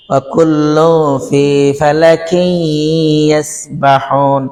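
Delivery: slow melodic recitation; Quran recitation. Shown as a demonstration. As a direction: chant the verse slowly. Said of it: "recites verse as example".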